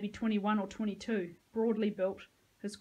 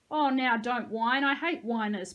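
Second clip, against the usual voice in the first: loud, raised mock sympathy; tut-tutting; 3 semitones higher, 6 dB louder than usual. A narrator's reading. Peyton to hurt Dean, tut-tutting. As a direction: scold the whiner condescendingly. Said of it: "Peyton tut-tutted".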